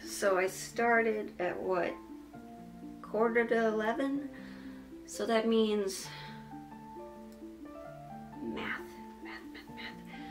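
drowsy, trailing into a breathy whisper; half-asleep mumbling numbers; sleep deprived. Real, not acted. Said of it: "raw footage of me whenever I'm sleep deprived".

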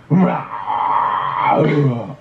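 drawn-out mock beast roar; bear-lion roar. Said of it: "곰이랑 사자 소리 합쳐서 내주세요".

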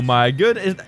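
normal talking voice

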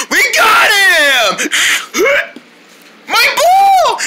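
high, slow cry of victory; triumphant shout. Acted. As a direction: cheer as they catch him. Triumph catching the germ.

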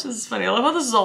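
usual speaking voice

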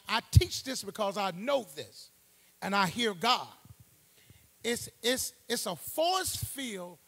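loud, rising sermon push; preaching at full force. Acted. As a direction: drive the point home like a preacher. Preacher rises again to full force.

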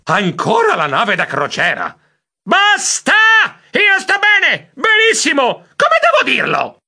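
high, loud yelling at wit's end; exasperated shout. A narrator's reading. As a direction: shout each phrase in exasperation. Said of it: "Io ormai esasperato gridai". (grido esasperato)

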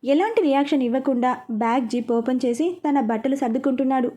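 plain reading voice

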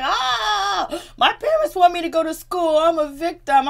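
loud, high-pitched mock wail; exaggerated fake-crying complaint; mocking imitation. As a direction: play the victim at full volume. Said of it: "the way he just imitated".

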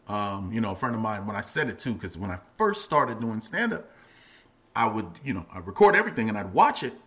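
ordinary delivery

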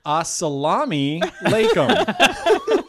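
loud, high, drawn-out salutation; big hammed-up hello; jokey crowd greeting. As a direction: belt it out slowly like a stage greeting.

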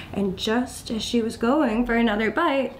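ordinary delivery

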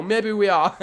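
ordinary delivery

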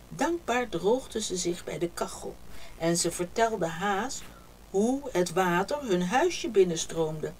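ordinary delivery, nothing marked

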